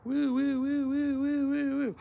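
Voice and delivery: high wailing "woo-woo" siren; siren imitation. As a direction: wail like an alarm, high and steady. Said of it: "It's got a siren on it".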